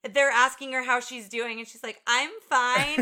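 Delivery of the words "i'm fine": mock shaken wife. Retold wife's shaken reply.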